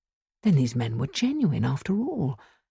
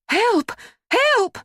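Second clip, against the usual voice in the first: high, loud cry for help; shouting for help; 5 dB louder than usual. A narrator's reading. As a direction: scream out to the neighbours. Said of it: "she shouted".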